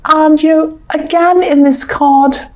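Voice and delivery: ordinary talk, nothing marked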